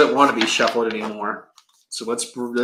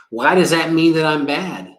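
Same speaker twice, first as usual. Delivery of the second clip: ordinary delivery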